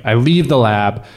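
usual speaking voice

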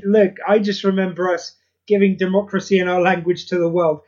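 ordinary talk, nothing marked

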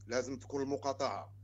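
ordinary delivery

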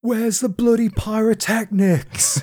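high, slow, shouty mock Ramsay rant; hammed-up celebrity chef yelling at the crew; Gordon Ramsay impression. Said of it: "a Gordon Ramsay voice".

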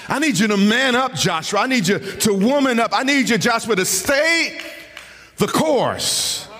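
preaching at full force. Acted. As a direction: rise to a fever pitch, urging the congregation. Escalating sermon at full force.

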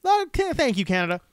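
mock gushing thanks. Mock grateful traveller voice.

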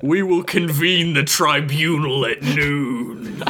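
slow, drawn-out court proclamation; stately mock-solemn ruling; mock tribunal judge. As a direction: intone it like a pompous tribunal.

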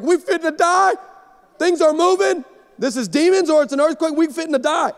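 hammed-up alarm at the shaking table; mock panic. Pastor retelling the earthquake breakfast, mock panicked and loud. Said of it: "I'm sitting at the table like".

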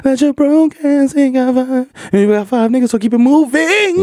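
high, slow, sleazy street dealer pitch; creepy dealer voice. Acted. Dealer whispering a pitch to a broke customer, high and slow. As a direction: lure the broke buyer in slowly.

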